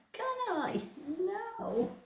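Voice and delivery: playful cat reply; voicing the cat. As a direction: answer for the cat in a tiny voice. Answering for the cat.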